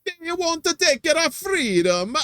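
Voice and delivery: put-on high, slow Italian-accented battle cry; mock Italian accent. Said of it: "I sound Italian".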